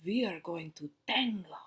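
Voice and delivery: hushed unvoiced whisper of a secret. A narrator's reading. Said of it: "whispered Missus Walush".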